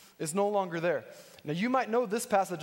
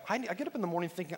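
usual speaking voice

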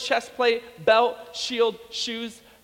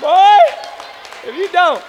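high, very loud scolding outburst; mock parental threat; 8 semitones higher, 11 dB louder than usual. Preacher playing a parent at a screaming kid, loud threat. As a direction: explode like a fed-up parent, played for laughs.

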